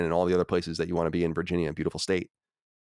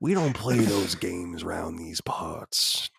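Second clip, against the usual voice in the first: slow whispered Southern drawl; hushed cowboy warning a stranger off; 4 semitones lower, 4 dB quieter, much slower than usual. Pretend. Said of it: "Red Dead voices".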